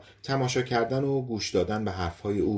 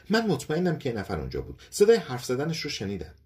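higher-pitched, emphatic certainty; insistent; 6 semitones higher than usual. A narrator's reading. Wife contradicting her doubting husband, insistent. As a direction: insist that you are sure.

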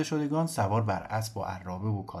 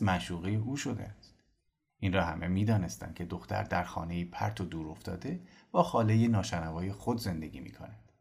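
usual speaking voice

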